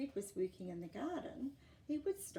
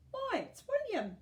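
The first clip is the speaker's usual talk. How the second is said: put-on much higher, louder happy old lady; Edna, delighted; 8 dB louder than usual. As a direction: welcome him like the best visit all week. Edna welcomes the boy.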